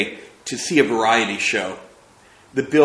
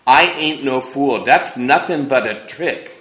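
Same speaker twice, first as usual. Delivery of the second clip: stubborn insistence; 4 semitones higher, 6 dB louder than usual. Acted.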